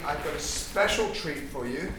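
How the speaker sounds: usual speaking voice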